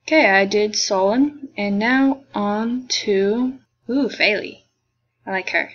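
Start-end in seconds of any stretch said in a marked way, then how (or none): none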